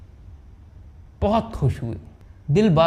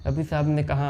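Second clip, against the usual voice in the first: ordinary delivery, nothing marked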